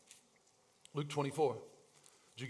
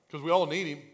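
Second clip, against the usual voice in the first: ordinary talk, nothing marked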